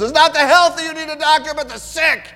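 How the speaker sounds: Jesus calls across the room to the Pharisees, loud and high; calling across the room. Retold or imitated. Jesus answers from across the room, shouting high. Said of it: "from far across the room".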